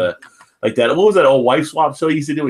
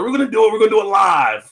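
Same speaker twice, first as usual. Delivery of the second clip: Bill O'Reilly impression; 7 semitones higher, faster than usual. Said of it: "Bill O'Reilly".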